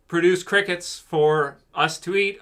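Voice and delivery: ordinary talk, nothing marked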